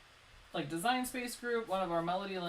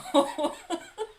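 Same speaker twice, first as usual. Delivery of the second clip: excited laugh; 10 semitones higher, 10 dB louder than usual. Real, not acted. Laughing at vote tally.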